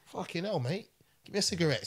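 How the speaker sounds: high, lightly put-on relaxed voice; easygoing Chopper. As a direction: wave it off like nothing happened. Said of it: "And Chopper's like".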